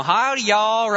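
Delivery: a quick jokey Southern drawl howdy. Retold or imitated. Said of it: "a redneck hick".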